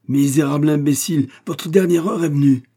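loud, high-pitched threat; furious. A narrator's reading. Inspector attacking Winkel with the hatchet, furious. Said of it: "si dans sa furie".

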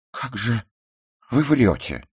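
high, loud angry accusation; spiteful. A narrator's reading. Ruth suddenly accuses the narrator of lying, spiteful. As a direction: turn on them with sudden spite. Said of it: "вдруг зло сказала она".

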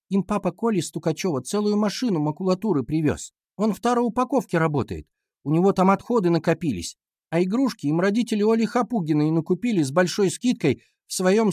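put-on high girl's voice, laying out the evidence; schoolgirl explaining. A narrator's reading. Class monitor explaining rivals' parents' help, high girlish voice. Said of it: "стала объяснять Ира".

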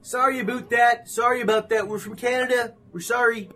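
cheerful Canadian apology voice; Canadian accent. Pretend. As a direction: play the overly polite Canadian. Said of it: "That's actually a good fucking, fucking accent".

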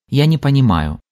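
ordinary delivery

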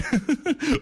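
a high, coquettish laugh. Real, not acted. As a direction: laugh flirtatiously. Laughs recalling first love.

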